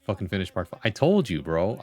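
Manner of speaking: ordinary talk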